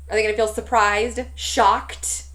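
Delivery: ordinary talk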